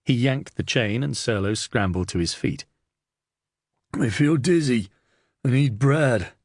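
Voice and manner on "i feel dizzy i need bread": high, plaintive prisoner's whine; whining. A narrator's reading. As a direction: whine for food and pity. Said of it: "Serlo whined".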